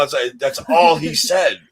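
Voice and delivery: ordinary talk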